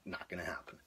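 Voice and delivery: breathy whispered resignation; whispering. Retold or imitated. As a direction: whisper it to yourself, giving up. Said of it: "I'm like".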